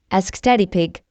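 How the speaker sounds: usual speaking voice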